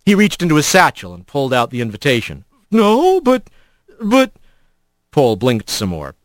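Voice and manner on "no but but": high, halting stammer; lost for words, pitched up; flustered surprise. A narrator's reading. Doorkeeper stunned by Rat's arrival, flustered.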